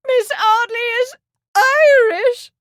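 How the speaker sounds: mother crying out in loathing; cried in loathing. Acted. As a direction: spit out the word Irish. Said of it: "Lady Marsden cried".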